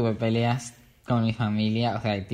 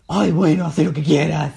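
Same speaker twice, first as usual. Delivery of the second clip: louder, exasperated brush-off; mock exasperated mom; 4 semitones higher, 7 dB louder than usual. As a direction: throw up your hands at the kid. (mamá harta, más fuerte, con fastidio)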